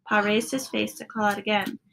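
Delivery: ordinary delivery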